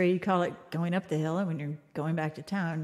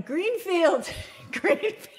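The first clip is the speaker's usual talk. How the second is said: imitated yelling; 10 semitones higher, much slower than usual. Storyteller imitating a lost driver yelling out the window, mock yell. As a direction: holler it high, breaking into laughter. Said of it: "he just starts yelling".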